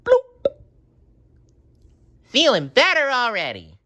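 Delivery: very high bubbly cartoon voice; a happy pop then relief; SpongeBob voice. A narrator's reading.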